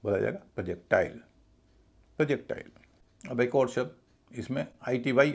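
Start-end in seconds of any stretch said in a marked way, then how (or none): 0.6-2.7 प्रोजेक्टाइल as "prə-JEK-tail"; light "pruh" opening on प्रोजेक्टाइल; correct reduced vowel. Shown as a demonstration. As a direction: weaken प्रो to प्र, stress JEK in प्रोजेक्टाइल. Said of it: "प्रो ना होके प्र हो जाएगा".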